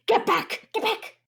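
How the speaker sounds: sharp urgent shout; shouted warning. Acted. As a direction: yell at them to get back. Warning party to retreat.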